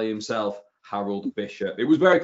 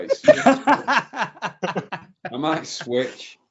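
laughing; 6 semitones higher, much slower than usual. Real, not acted. Laughing while teasing host.

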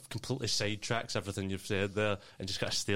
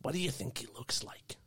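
soft unvoiced whisper; 4 semitones higher, 4 dB quieter than usual. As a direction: whisper it like a secret. Kid whispers conspiratorially.

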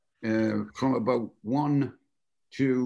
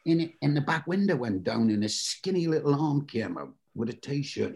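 ordinary delivery

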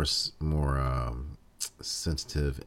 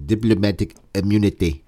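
loud South African film-villain quote; South African accent; 3 semitones higher, 8 dB louder than usual. Acted. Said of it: "say it in my South African voice".

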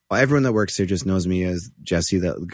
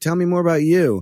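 bright fake interest; 8 semitones higher than usual. Switching topic brightly.

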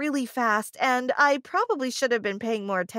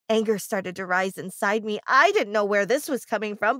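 ordinary delivery

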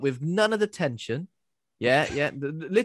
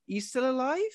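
much higher, startled question; surprised; 10 semitones higher than usual. Retold or imitated. Viewer surprised that Martin survived, startled question. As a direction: gasp it out in disbelief. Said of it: "I was like".

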